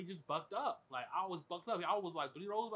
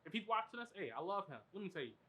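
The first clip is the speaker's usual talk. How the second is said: ordinary talk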